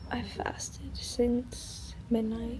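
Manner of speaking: slow hushed whisper; whispering on the phone. Real, not acted. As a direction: whisper it slowly so nobody hears. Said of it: "I have to whisper".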